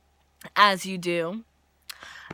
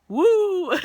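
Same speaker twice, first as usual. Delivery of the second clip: loud, high full-voiced whoop; big celebratory cheer; successful woo; 9 semitones higher, 8 dB louder than usual. Shown as a demonstration. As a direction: let the woo ring out triumphantly. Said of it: "There we go".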